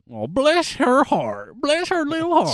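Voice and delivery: syrupy Southern accent, rising high; Southern lady voice, sweetly condescending; southern twang. Shown as a demonstration. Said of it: "bring in that southern twang".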